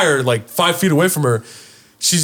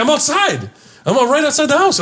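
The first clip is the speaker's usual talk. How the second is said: mom answering; 12 semitones higher than usual. Retold or imitated.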